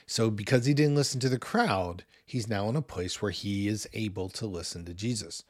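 normal talking voice

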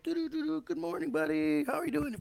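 lilting fake-friendly good morning; sweetly taunting hello; sing-song greeting. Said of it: "every morning".

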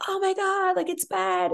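high, soft alarmed neighbor; soft, high "oh my God, it's bad"; doctor's worried voice. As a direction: mimic the doctor's hushed worry.

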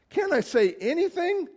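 high whining plea; whiny. Retold or imitated. David whining back at his brother, mock whiny. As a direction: whine it like a picked-on kid brother. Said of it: "sort of whiny".

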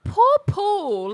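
poor Paul, overdone sorrow; mock pity. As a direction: wail it in hammed-up sympathy.

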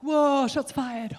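mock-scandalised whoop from the crowd; hyped onlooker. Voicing the onlookers with phones.

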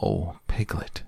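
ordinary delivery

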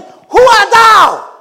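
booming Who art thou, slow; slow shouted challenge; loud demanding question. Acted. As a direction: thunder the question as old Isaac. Isaac asks again.

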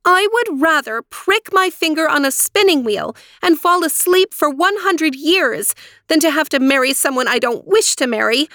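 high, resolute princess; firm voice. A narrator's reading. Aurora declaring her decision to three aunts, firm. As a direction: stand your ground, emphatic and high. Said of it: "in a firm voice".

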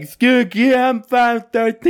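put-on slurred, slow, high and loud drunk voice; drunk self. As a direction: play your drunk self begging the desk clerk. Imitates his drunk self asking.

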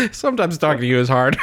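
bursting with laughter; laughing. Real, not acted. Laughing at friend's answer.

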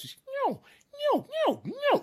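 very high squeaky mewing cow calls; elk cow calls. Retold or imitated. Hunter imitating the cow elk calls he heard. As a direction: mimic cow elk mewing all around. Cows calling from everywhere.

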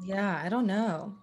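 ordinary talk, nothing marked

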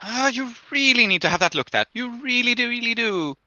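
high, insistent fretting; fretting lunch lady. Acted. Lunch lady urging injured man to get treated, fretting. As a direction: fuss and urge, voice held high.

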